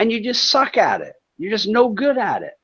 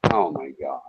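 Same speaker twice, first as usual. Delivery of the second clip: ordinary talk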